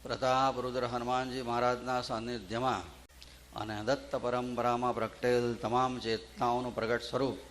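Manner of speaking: bow in reverence, measured and hushed; formal invocation. Acted. Opening invocation before the bhajan.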